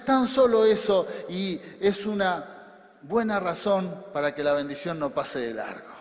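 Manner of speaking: normal talking voice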